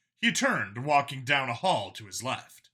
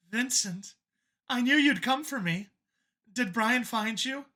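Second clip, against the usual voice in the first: mother waking; 9 semitones higher than usual. Acted.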